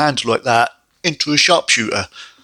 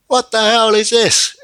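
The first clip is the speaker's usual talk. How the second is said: much higher, slower, indignant what-the-hell; baffled outburst; 10 semitones higher, 3 dB louder than usual. Real, not acted. Reviewer baffled by a vampire on the show, high indignant disbelief. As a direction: throw it up in bewildered outrage.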